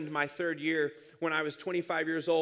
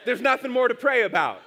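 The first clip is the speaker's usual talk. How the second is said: louder, higher playful shout; mock shout; 7 semitones higher, 9 dB louder than usual. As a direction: belt it out as God's punchline. God's punchline, shouted.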